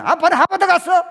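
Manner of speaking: put-on high, loud, laughing sassy kid; child talking back. Child retorting to a nagging father, loud and high. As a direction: fire it back like a cheeky kid.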